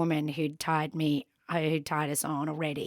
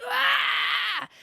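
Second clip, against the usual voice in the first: put-on baby-like wail. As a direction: cry out like a startled baby. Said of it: "like a baby".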